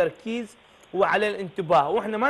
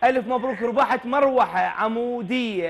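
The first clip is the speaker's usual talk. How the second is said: booming prize announcer; 4 semitones higher, slower than usual. Acted. Host announces prize win.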